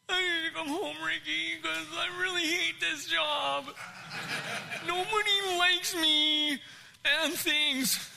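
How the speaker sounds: high, drawn-out tearful wail; mock crying. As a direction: wail it out like a crybaby. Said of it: "just crying and just going".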